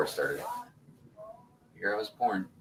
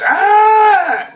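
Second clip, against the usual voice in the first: goat scream imitation; 12 dB louder than usual. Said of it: "it goes".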